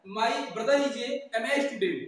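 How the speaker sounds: very slow, careful model sentence; slow example sentence. Shown as a demonstration. Teacher slowly models an English sentence for students, measured pace. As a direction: stretch every word for the class. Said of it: "कभी कभी MA लिखना होता है".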